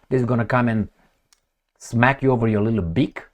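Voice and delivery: ordinary talk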